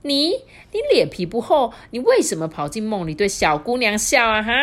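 high, loud accusing; jealous wife scolding; jealous old wife. Acted. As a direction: nag her husband loudly. Old woman accuses husband.

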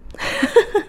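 short high giggle at her own teaser; quick high laugh; burst of laughter. Real, not acted. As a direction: giggle as if hiding a secret. Teasing the big reveal.

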